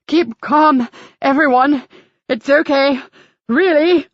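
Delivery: calming the group while fighting for breath; breathless gasping. Acted. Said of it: "he gasped".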